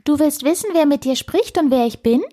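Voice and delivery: high, piping little creature; squeaky voice. A narrator's reading. Unseen squirrel asks whether the girl wants its name, squeaky. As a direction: tease with a high squeaky question. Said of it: "piepste daraufhin die Stimme erneut".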